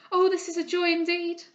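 high, loud burst of joy; fervent joyful cry. A narrator's reading. Henrietta greets her recovered friend, crying with joy. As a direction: cry out overjoyed. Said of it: "cried she".

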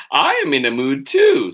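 put-on very high, loud child's complaint; indignant child. Charlie grumbles, first reading.

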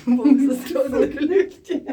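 loud, high, slow words through laughter; real laughter breaking through; laughing announcement. Real, not acted. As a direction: crack up while announcing it, drawn out. Laughs announcing they are stuck.

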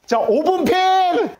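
high, slow showman announcement; drawn-out announcer call. Pretend. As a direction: call it out like a game show host. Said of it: "이번에 다른 거 한번 해보자".

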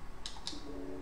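long, unvoiced exasperated sigh; frustrated sigh. Real, not acted. Streamer losing the game, heavy sigh. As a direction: let all the air out in frustration.

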